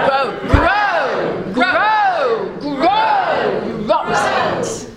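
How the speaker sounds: high, drawn-out acted shouts; cross storybook yelling; shouting. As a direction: holler at stubborn seeds. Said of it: "she started shouting at the seeds".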